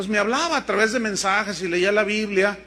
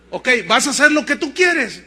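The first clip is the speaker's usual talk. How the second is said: loud, higher retort; acted, forceful reply with raised voice; exasperated God; 4 semitones higher, 8 dB louder than usual. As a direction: throw it back at a stubborn servant. Voices God answering his refusal.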